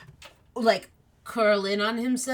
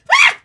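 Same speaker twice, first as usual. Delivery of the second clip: startled yelp; 14 dB louder than usual. Acted.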